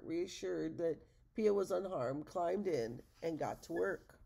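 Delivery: ordinary delivery, nothing marked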